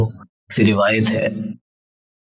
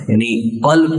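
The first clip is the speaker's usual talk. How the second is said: usual speaking voice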